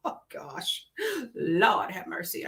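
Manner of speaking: breathed-out weary sigh; exasperated breath. Real, not acted. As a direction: sigh it out, worn out. Sigh recalling the mess.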